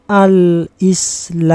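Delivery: Al-Islamu drawn out slowly, final u clear; over enunciating. Shown as a demonstration. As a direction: read Al-Islamu slowly and carefully. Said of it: "Silakan dibaca".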